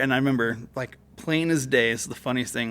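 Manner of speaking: normal talking voice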